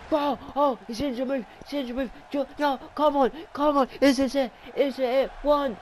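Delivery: very high, loud pleading; desperate excitement. Real, not acted. Commentator pleading for the pin, very high and frantic. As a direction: beg for the pin. Near fall, hoping for pin.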